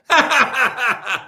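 short high laugh. Real, not acted. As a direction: laugh at the punchline. Laughs at own joke.

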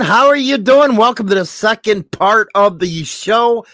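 high, loud, hyped greeting; excited show host. Real, not acted. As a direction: pump up the audience at the start.

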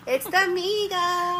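bright high sing-song hello; sing-song intro. Acted. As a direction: call it out like a show intro.

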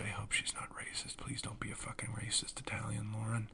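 whispered aside. Real, not acted. Vlogger whispering a nervous aside about the actress. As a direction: whisper it so she won't hear. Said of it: "aside to the camera".

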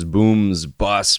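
usual speaking voice

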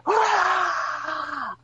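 high mock crowd roar; imitating a crowd's big cheer; imitated crowd pop. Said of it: "everybody in the crowd".

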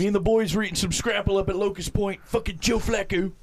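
high Baltimore local; Baltimore accent. Retold or imitated. Continues Baltimore local impression.

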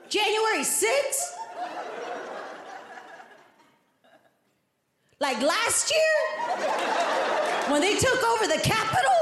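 high, rising incredulous questions; comic astonishment, pitched well up; disbelieving cop. Retold or imitated. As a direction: check each detail in mounting mock disbelief. Cop realizing the date's significance.

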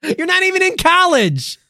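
high, loud yell of disbelief; exasperated shout. Acted.

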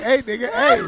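mock street greeting. Friend mocks comic's casual hello, with a laugh. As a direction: imitate her loose hello. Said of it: "you like".

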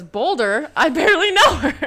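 proud punchline. Retold or imitated. Host sharing her favourite caption, laughing. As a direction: land the pun proudly. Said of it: "my caption was".

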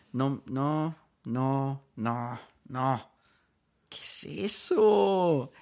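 un leve tono de asco remilgado, con el "¿Qué es eso?" agudo y muy lento; exigente que rechaza. Retold or imitated. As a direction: rechaza a todos con desdén ligero. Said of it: "mi segunda versión ... estoy picky". (versión exigente: un toque de desdén burlón)

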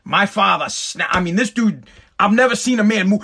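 ordinary talk

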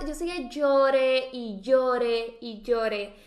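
very slow, stretching each llore; drawn-out emphasis. Real, not acted. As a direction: drag out the endless crying. Énfasis en llanto sin fin. (muy lento, alargando cada llore)

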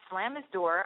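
usual speaking voice

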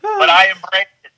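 laughing out the words. Real, not acted. Guest laughing to the host about his creepy roles, laughing. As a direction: giggle through a sheepish admission.

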